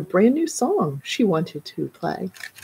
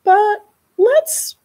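high bright mother voice; 12 semitones higher, 4 dB louder, slower than usual. A narrator's reading. Mother praises, then redirects.

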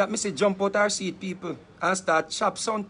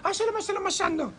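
sudden high-pitched gibberish; resumed fake tongues; 11 semitones higher than usual. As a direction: burst back into showy mock tongues after peeking. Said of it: "go so again".

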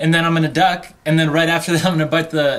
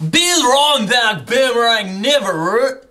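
higher, slow, nonsense Aussie gibberish; fake Australian accent; 5 semitones higher, slower than usual. Escalating the bit at his friend, hammy Australian gibberish. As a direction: babble Aussie-sounding nonsense. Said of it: "distinctly Australian accent".

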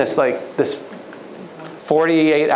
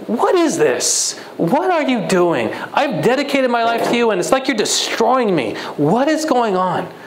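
tearful prayer; 7 semitones higher than usual. Retold or imitated. Hungry, desperate believer pleads with God in bed. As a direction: cry out to God in despair. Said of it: "and I was just crying".

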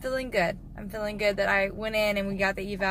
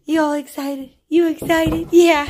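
louder, higher excited teasing; excited hyping; 6 semitones higher, 9 dB louder than usual. Real, not acted.

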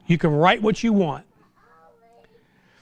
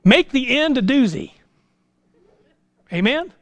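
ordinary talk

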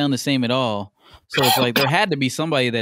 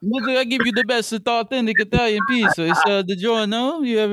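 much higher hammed-up Italian chef voice; mock Italian accent; 9 semitones higher than usual. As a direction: sell the pizza like a cartoon Italian. Acting Italian pizza chef.

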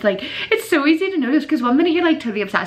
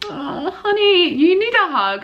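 tender sympathy; 7 semitones higher, slower than usual. Real, not acted. Comforting an anonymous sender.

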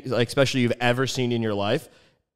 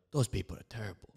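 hushed gossip; 4 semitones lower, 16 dB quieter, faster than usual. Retold or imitated. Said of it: "They're like".

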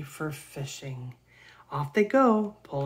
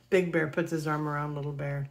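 plain reading voice

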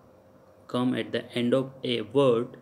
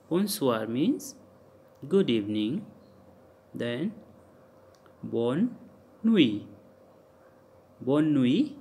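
normal talking voice

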